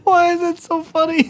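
high laughing question. Real, not acted. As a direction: squeal it through laughter. Laughing at hurt friend.

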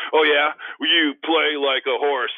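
loud, high retold taunt; trash-talk comeback. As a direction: fire back a big mock comeback.

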